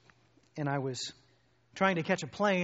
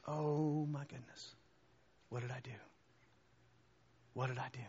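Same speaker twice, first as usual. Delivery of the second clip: stricken remorse; 3 semitones lower, 9 dB quieter than usual. Retold or imitated. David confronted by Nathan, quietly horrified. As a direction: let guilt sink in softly.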